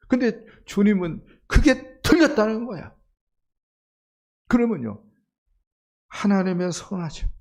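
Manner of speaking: impassioned pulpit exhortation; fervent preaching. Acted. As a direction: preach it with rising fervor. Preaching at full force.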